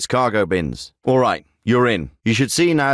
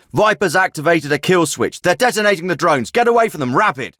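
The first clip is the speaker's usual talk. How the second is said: raised, high-pitched alarm; urgent warning; 6 semitones higher, 3 dB louder than usual. Acted. Officer warns team to get away from exploding drones, urgent shout.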